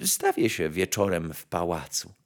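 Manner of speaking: soft, low, compliant voice; modest nightingale. A narrator's reading. Nightingale accepting the palace invitation, soft and humble.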